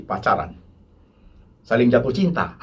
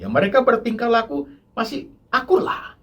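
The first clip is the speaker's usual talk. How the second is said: ordinary talk, nothing marked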